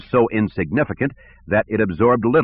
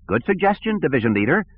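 eager, higher, smiling director; smiling eagerly; 8 semitones higher than usual. Acted. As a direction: relish the idea, smiling. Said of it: "with a smile of anticipation".

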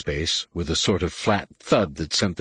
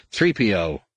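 plain reading voice

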